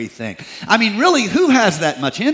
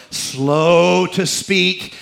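drawn out slowly; much slower than usual. Real, not acted. Reading the verse on slowness.

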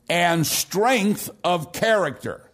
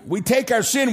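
normal talking voice

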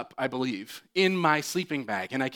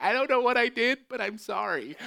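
high, apologetic dog voice; the dog apologizing to the boy; sheepish dog voice; 9 semitones higher than usual. Retold or imitated. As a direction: voice the dog's confused apology. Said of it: "She's like".

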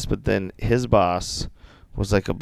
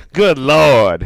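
loud, higher mock dismay; scandalized boss; 5 semitones higher, 6 dB louder than usual. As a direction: imitate an appalled boss's outcry. Reprises the scandalized boss's catchphrase.

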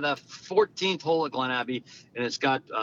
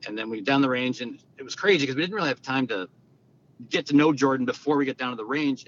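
ordinary talk, nothing marked

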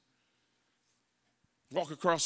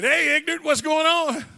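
much higher, louder hearty dad voice; booming dad greeting; 11 semitones higher, 11 dB louder than usual. Retold or imitated. Father answering his son's phone call, loud and jovial. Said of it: "he answered and said".

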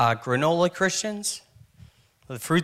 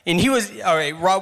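ordinary delivery

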